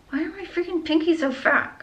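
high, half-laughing whine; high-pitched complaint. Real, not acted. Struggling with a tight ring, high half-laughing whine.

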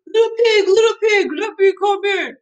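high, loud singsong wolf voice; big bad wolf. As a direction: call out high and playfully menacing. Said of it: "he went to the other house and he said".

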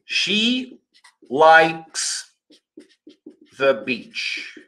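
She, likes, the beach with pauses between; each word set apart. Shown as a demonstration. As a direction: split the words with gaps. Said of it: "olha só".

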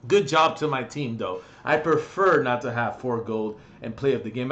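ordinary delivery

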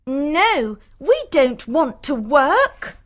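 high, whining sisters refusing; high-pitched complaining young women; petulant sisters. Acted.

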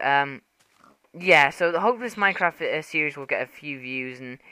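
ordinary talk

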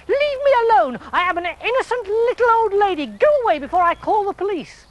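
indignant granny shooing him off; little old lady. A narrator's reading. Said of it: "the little old lady said".

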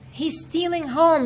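high excited call; yelling. A narrator's reading. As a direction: shout the alarm across the field. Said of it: "yelled the catcher".

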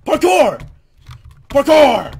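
loud, high yell of a catchphrase; high-pitched, punchy mock battle cry; playful shout. Mock battle cry while playing.

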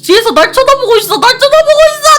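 high, loud terrified shriek; frightened screaming that someone is approaching; panicked scream. Real, not acted.

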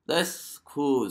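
real excited approval; impressed. Real, not acted. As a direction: exclaim it, genuinely amazed. Reacting with amazement to a video.

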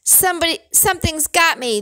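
high-pitched frantic cry; screamed alarm, without full volume; panicked scream. A narrator's reading. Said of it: "the other man screamed".

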